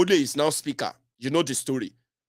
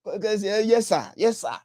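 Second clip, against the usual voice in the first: mocking flatterers; 5 semitones higher than usual. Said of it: "to make them look like".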